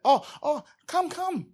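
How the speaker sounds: high, sweet, sucking-up voice; suddenly eager to please; fawning. Retold or imitated. Crowd suddenly fawning over the beggar, high sweet voice. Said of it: "they changed their tune".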